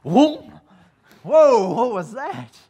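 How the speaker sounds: startled cry at a sudden kick; startled whoa. Pretend. Preacher imagining Elizabeth's belly at the leap, startled and loud. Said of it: "Elizabeth's belly just going".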